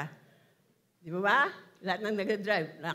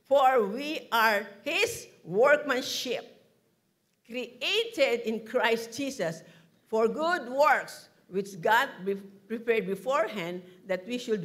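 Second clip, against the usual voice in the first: slow, weighty reading of the verse, every word set apart; slow scripture reading; 4 dB louder, slower than usual. Acted. Preacher reads Ephesians to the congregation, slow and solemn. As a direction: read it out like holy writ.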